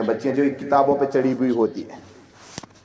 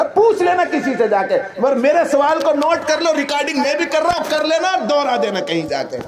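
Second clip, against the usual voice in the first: emphatic challenge; 11 semitones higher than usual. Real, not acted. Preacher challenging the audience, raised insistent voice. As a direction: dare them to check.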